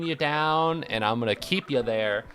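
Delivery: building to a high, loud shouted threat; hammed-up menacing tirade; mock villain rant.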